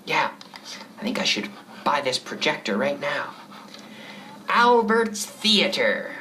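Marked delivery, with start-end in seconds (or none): 4.5-6.1 slow, grand Albert's Theater; grand announcement. Acted. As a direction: announce it like a marquee, slowly.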